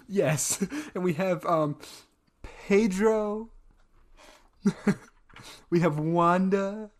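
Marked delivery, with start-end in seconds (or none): 2.4-3.5 deliberately wrong name. Shown as a demonstration. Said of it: "deliberately saying the wrong name".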